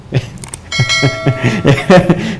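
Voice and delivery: long amused chuckle; laughter. Real, not acted. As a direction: laugh at your own joke. Laughs at his own student joke. (tertawa geli)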